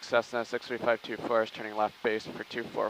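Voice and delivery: usual speaking voice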